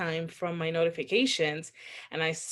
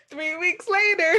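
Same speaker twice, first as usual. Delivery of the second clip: laughing; 5 dB louder than usual. Real, not acted. Laughing through the story's twist.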